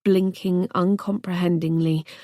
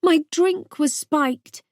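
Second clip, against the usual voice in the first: distressed slow confession; 8 semitones higher, slower than usual. Acted. Revealing she was drugged.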